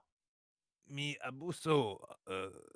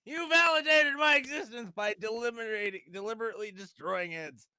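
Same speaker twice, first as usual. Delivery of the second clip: high, loud victory cry; triumphant shout; 9 semitones higher, 9 dB louder than usual. Pretend. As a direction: crow over the win. Mock triumphant thanks.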